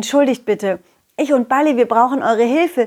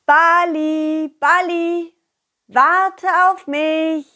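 high call after the ball; 6 semitones higher, much slower than usual. Acted. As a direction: call him back, drawn out.

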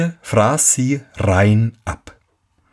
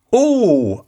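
lügender Sohn; 11 semitones higher than usual. Acted. Lügender Sohn antwortet dem Vater.

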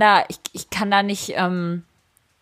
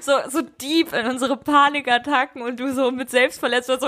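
amused; 6 semitones higher than usual. Real, not acted. Still amused, teasing co-host.

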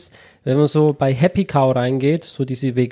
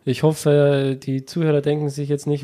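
normal talking voice

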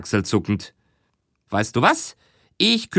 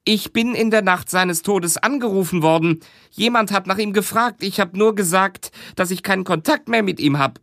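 higher, worried woman's voice; higher voiced woman confessing her worry; woman witness; 8 semitones higher than usual. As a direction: speak up high as an anxious witness.

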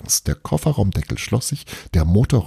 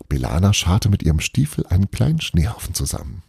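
plain reading voice

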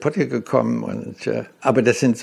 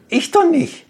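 sharply raised, louder protest; emphatic denial; 4 dB louder than usual. Real, not acted. To the interviewer, denying any plot: emphatic, high protest. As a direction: insist it was never you. Denies wanting to oust him.

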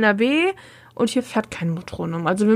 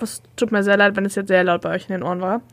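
ordinary talk

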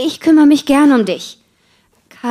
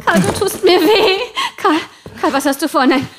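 panicky, higher pleas; frightened pleading; 4 semitones higher than usual. Acted. Marie begs her husband not to tie her up, frightened. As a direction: beg him to stop, rising fear. Wife pleads while being tied up.

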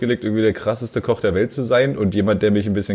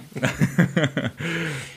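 soft giggle; 5 semitones higher, 6 dB quieter than usual. Real, not acted.

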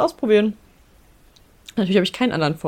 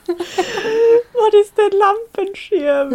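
incredulous; 4 dB louder, much slower than usual. Real, not acted. Incredulous slow high question.

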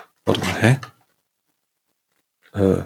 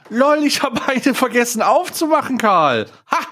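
excited; 13 semitones higher than usual. Real, not acted. Excited discovery of forgotten door.

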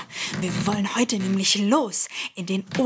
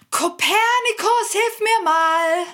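high, slow shout for help; shouting for the cat to come help; calling out; 9 semitones higher, slower than usual. Acted. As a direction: call up the stairs for him.

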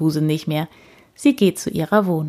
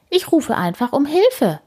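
a lightly voiced higher old lady; pleased with her idea; Frau Usebuse thinking; 8 semitones higher than usual. A narrator's reading. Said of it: "denkt sie".

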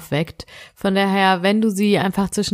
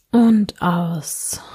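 sleep meditation; slower than usual. Acted. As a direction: guide the listener gently into sleep.

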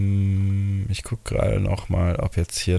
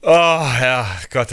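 relieved cheer; 4 semitones higher, 5 dB louder, slower than usual. Real, not acted. Relief after tense game.

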